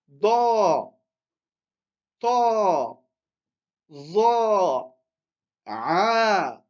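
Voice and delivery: long sustained "a" on "Da, ta, za, a, ra, fa, qa, ka, la"; drawn-out long vowels. Shown as a demonstration. Teacher reading the lengthened letters to students, each "a" in "Da", "ta", "za" held long. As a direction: hold the "a" of each syllable, "Da", "ta", "za", one by one. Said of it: "ici, c'est seulement on allonge".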